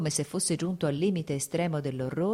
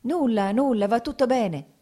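rassicurante; 6 semitones higher, 5 dB louder than usual. Acted. Dolly rassicura Lievin che fugge.

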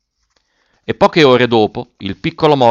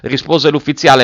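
usual speaking voice

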